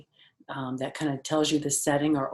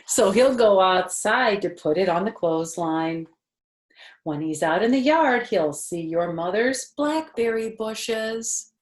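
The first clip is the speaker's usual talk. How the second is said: higher, louder storybook voice; reading to children; 5 semitones higher, 8 dB louder than usual. Acted. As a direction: read it brightly to a little listener. Reading picture book aloud.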